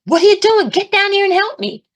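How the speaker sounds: high, loud exasperated yell; yelling at God. Retold or imitated. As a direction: re-enact an outraged plea to heaven. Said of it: "I kept yelling at God".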